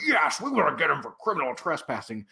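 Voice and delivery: mock officious confirmation; imitated emphatic yes to a question; insistent guard. Retold guard insists in answer.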